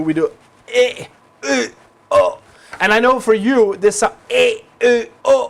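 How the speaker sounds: loud retching eh, eh, oh from the throat; Danish throat sounds. Shown as a demonstration. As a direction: bark the Danish vowels like about to throw up. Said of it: "it's the h sound we do".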